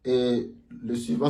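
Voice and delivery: ordinary talk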